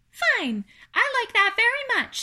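high, loud little voice; pleased little spider. Acted. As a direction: accept happily as a tiny spider.

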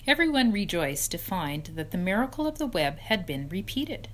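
plain reading voice

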